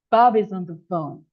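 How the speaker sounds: "Bob is on the phone" linked in one smooth run; connected sound group. Shown as a demonstration. English teacher showing learners how to say it, words linked smoothly. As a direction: run BOB-IS-ON-THE-PHONE together without gaps, falling at the end. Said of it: "you have to say like this".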